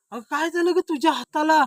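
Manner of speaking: high, loud burst of concern; startled question. Acted. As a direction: exclaim at the sight of the injury. Startled by wife's hand. (दचकून मोठ्याने विचारणे)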